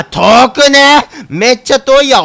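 slow, drawn-out, high, loud cry; shocked shout. Real, not acted. Shocked slow shout at how far the mission is. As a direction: stretch out the outrage at the distance.

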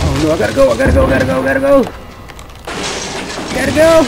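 panicked fleeing. Real, not acted.

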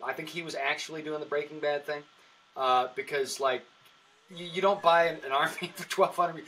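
ordinary delivery

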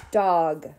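Dog as one separate, drawn-out beat; clapped syllable. Shown as a demonstration. Teacher leading preschoolers in clapping, Dog as one clapped syllable. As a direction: say Dog on a single clap, set apart. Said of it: "clap the syllables in the word dog".

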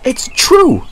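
Henry insists he's right; fuming. A narrator's reading. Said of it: "fumed Henry".

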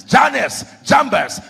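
calling out. Acted. Pharaoh summons his magicians against Moses, calling out. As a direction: summon them by name, loudly.

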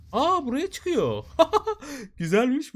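high, loud, delighted cry with a laugh; thrilled high laughing exclamation at a good spot; excited squeal. Real, not acted. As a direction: burst out thrilled. Excited at spotting a path.

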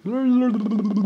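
high, loud babbling blahs; mocking gibberish. Man mocking what young kids say, mocking gibberish. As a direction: mimic young kids as high-pitched noise. Mocks young kids' chatter.

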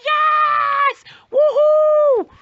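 loud, rising party whoop; yelling woohoo at the rave; whooping shout. Acted. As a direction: cheer like the drop just hit. Cheering, getting on it.

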